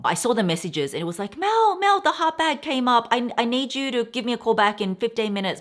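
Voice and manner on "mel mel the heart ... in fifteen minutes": much higher, louder frantic call of her name; urgent salesman. Retold or imitated. Sales associate urging Mel to call back, excited high rush.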